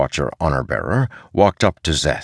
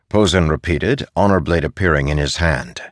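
usual speaking voice

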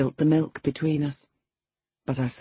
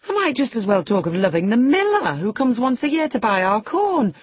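louder, higher, mocking nurse, fast in places; scornful scolding; 5 semitones higher, 4 dB louder than usual. A narrator's reading. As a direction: ridicule the girl's love letters. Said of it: "as scornfully as I could utter the word".

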